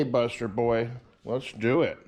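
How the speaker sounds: ordinary talk, nothing marked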